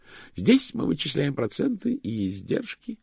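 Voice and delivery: high, fervent pedant's voice; high-pitched, proud clerk; zealous chief cashier. Acted.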